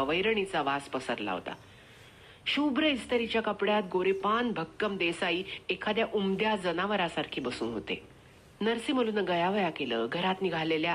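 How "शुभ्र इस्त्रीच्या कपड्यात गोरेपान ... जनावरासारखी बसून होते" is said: usual speaking voice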